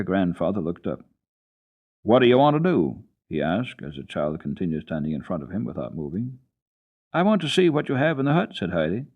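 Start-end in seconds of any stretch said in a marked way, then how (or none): 2.0-3.0 gruff question. A narrator's reading. Grandfather asks, voiced. 7.1-8.5 put-on eager child. A narrator's reading. Child Heidi voiced.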